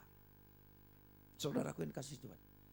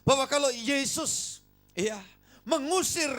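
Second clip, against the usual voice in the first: higher, louder fervent preaching; preaching at full force; 10 semitones higher, 9 dB louder than usual. Acted. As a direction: proclaim it boldly.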